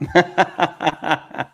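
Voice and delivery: loud amused laugh, high; a genuine burst of laughter; laughing at the absurd question; real laugh. Real, not acted.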